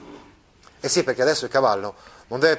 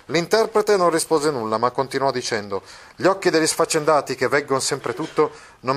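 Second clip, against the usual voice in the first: animated reading, higher and a touch faster; story narration; 4 semitones higher, 3 dB louder than usual. Acted. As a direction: declaims the farewell to the lovers. Reading the novel aloud. (narrazione letta con enfasi)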